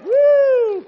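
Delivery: high, loud whoop of delight; excited cheer. Real, not acted. Quiz host cheering the right answer, loud whoop. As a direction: let out a big celebratory woo.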